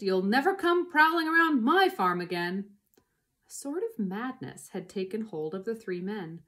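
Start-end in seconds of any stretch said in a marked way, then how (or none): 0.0-2.8 loud, raised warning; yelling. A narrator's reading. Boggis warning the fox off his farm, loud yell. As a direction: yell it to scare off a thief. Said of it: "yelled Boggist".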